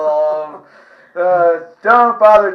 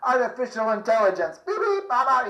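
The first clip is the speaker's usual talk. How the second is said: robot; 5 semitones higher, 6 dB quieter, faster than usual. Retold or imitated. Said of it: "act like a robot".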